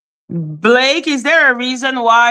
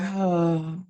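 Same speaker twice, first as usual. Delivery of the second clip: weary sigh; 5 semitones lower, 10 dB quieter than usual. Retold or imitated. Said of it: "So I'm like".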